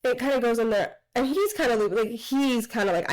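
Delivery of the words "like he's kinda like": ordinary talk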